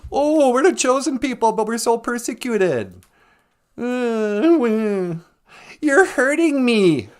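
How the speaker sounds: high, loud, exaggerated whine; mock bellyaching. Comic mimicking complainers from scripture, high mock whine.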